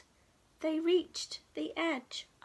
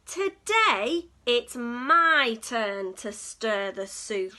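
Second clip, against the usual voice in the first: determined announcement; 2 semitones lower, 8 dB louder, slower than usual. Acted. Duck claims the spoon.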